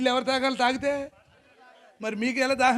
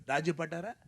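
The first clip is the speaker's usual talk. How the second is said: usual speaking voice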